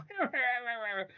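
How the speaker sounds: high-pitched mocking cackle; put-on high laugh; imitated laughing. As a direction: imitate a friend cracking up. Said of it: "he's, like, laughing".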